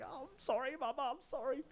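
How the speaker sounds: very high, mock-pleading falsetto; pleading little boy. Naughty boy apologising to grandma, high mock pleading. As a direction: beg forgiveness in a tiny put-on child's voice. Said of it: "And I'm like".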